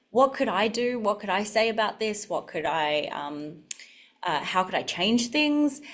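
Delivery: normal talking voice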